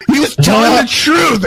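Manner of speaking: high mock holler breaking into laughter; calling across a lot. Storyteller reenacting the yell about the truthful twin, laughing holler. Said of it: "from across the lot".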